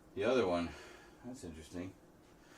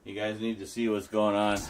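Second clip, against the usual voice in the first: ordinary talk, nothing marked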